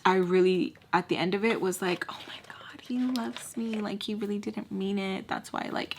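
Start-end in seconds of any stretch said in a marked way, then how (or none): 2.1-5.9 very soft, breathy whisper; hushed, dreamy self-convincing; naive wonder. Retold or imitated. Girl convincing herself he loves her, whispered wonder. Retells naive loving thought.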